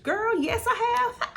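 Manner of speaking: gleeful high-pitched proud answer; excited yes. Real, not acted. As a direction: squeal it out with delight. Proudly answering "you changed.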